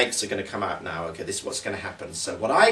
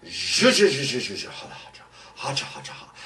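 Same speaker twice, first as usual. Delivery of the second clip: chanted spell sliding into a fading ha ha; sing-song the magic words. Acted. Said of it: "Let's try the magic words".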